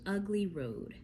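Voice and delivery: ordinary delivery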